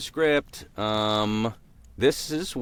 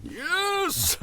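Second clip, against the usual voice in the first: high gleeful yelp; excited squeal. Pretend. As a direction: squeal with joy. Mock excited reaction.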